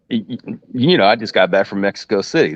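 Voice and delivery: usual speaking voice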